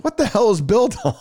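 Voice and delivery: mock disbelief, laughing through it; laughing coworker quote. Coworker down the hall, puzzled, laughing. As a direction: laugh while playing the baffled coworker. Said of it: "He goes".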